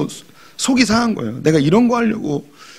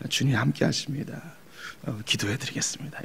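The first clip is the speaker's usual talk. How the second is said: soft, gentle pastor's reassurance; meek and humble; 12 dB quieter than usual. Retold or imitated. Pastor gently comforting a suffering believer, meek and soft. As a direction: hush it down, meek and mild. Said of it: "온유하고 겸손한 자이기 때문에".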